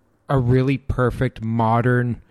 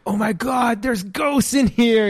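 high, slow mock alarm; hammed-up gasping about ghosts; mock scared ghost hunter; 9 semitones higher than usual. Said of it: "they're in there like".

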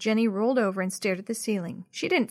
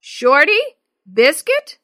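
loud, higher, rising pet call; calling louder; 6 semitones higher, 12 dB louder, slower than usual. A narrator's reading. Dad to the lost dog, calling out. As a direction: call out with an offer of treats. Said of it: "Then louder he called".

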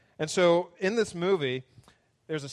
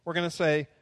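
ordinary talk

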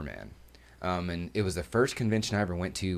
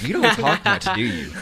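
higher and louder, teasing the mannequin; retold chat-up line; 12 semitones higher, 9 dB louder than usual. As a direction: call it out playfully to a silent stranger. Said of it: "and I was like".

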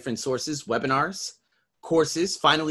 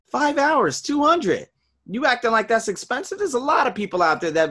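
much higher, louder outrage; indignant rant; 11 semitones higher, 5 dB louder than usual. Real, not acted. Coach rants at a client who calls it expensive, high and loud. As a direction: throw the price back in disbelief.

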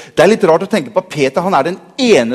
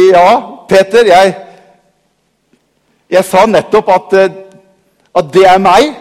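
patient Jesus; 3 semitones higher, 6 dB louder than usual. Acted. Jesus answers Peter, amused.

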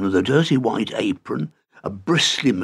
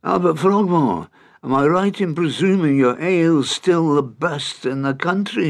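cheery raised greeting; hearty Scottish leprechaun; 6 semitones higher than usual. A narrator's reading. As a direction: greet him like an old pal.

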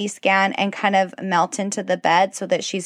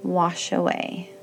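guided meditation. Acted. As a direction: gently guide them into stillness. Leads a kids' meditation.

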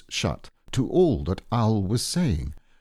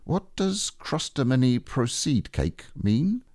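lightly raised bear voice; a small bear puzzled by long words; gentle higher Pooh voice; 6 semitones higher than usual. Acted. Pooh asks in character.

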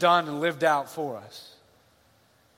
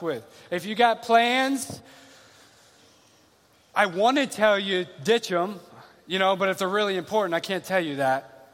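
preaching build-up; 4 semitones higher than usual. Acted. Rising exhortation to congregation.

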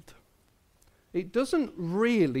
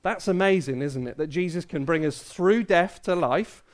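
ordinary talk

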